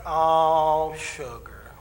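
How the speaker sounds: imitating a counselor's syrupy comfort; mock soothing. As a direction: coo it slowly as if rocking someone. Counselor mock-comforts him on the phone.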